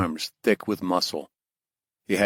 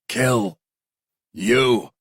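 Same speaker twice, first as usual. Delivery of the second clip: forceful, raised, word-by-word threat; higher, emphatic defiance; defiant threat; 4 semitones higher, 4 dB louder than usual. Acted. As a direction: push each word out hard with a gap between. Coughing prisoner finishes his threat.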